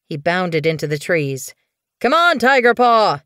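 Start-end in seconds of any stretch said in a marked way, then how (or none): 2.0-3.2 high, loud rallying shout; calling out. Acted. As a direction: call back over your shoulder to hurry him along. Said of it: "he called over his shoulder".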